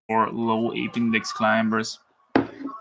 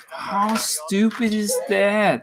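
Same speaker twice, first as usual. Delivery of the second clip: exasperated; 8 semitones higher, much slower than usual. Real, not acted. Frustrated at the climbing rules.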